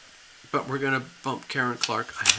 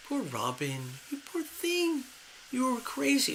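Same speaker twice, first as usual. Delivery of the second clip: soft, cooing sympathy that jumps high on "you poor thing"; tender pity; 9 semitones higher, 6 dB quieter than usual. Pretend. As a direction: coo over her like a lost pet. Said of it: "you kind of go to yourself like".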